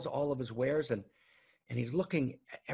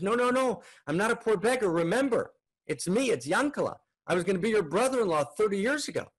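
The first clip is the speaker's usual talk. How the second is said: higher, louder, pleading to be recognized; urgent insistence; 7 semitones higher, 4 dB louder than usual. Acted. Man insisting to his brother-in-law, higher and louder.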